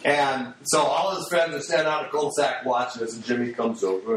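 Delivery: ordinary talk, nothing marked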